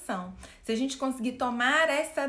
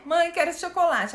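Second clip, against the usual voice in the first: put-on higher, louder little-boy whine; little boy begging; 5 semitones higher, 4 dB louder than usual. Kid at the supermarket begging his mom, higher whiny child voice. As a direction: beg mom for the chocolate like a small kid. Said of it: "o menininho lá, uns quatro, cinco anos".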